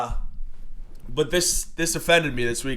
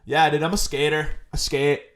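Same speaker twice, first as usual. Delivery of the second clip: usual speaking voice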